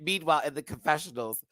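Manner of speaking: ordinary talk, nothing marked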